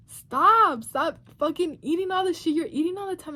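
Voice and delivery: a half-joking loud self-scold; mock self-scolding. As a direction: snap at yourself to quit eating. Scolding herself on the scale.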